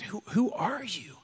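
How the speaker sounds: hushed whisper at your own reflection; whispered to the mirror. Retold or imitated. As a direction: breathe it out to yourself, almost unvoiced. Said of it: "just said".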